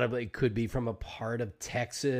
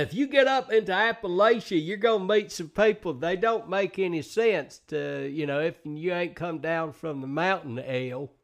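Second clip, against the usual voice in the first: high Appalachian mountain twang, easing down; Appalachian accent; 5 semitones higher than usual. Acted. Showing how mountain folk talk, high Appalachian accent. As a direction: play an Appalachian mountain local. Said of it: "the accents, all of it".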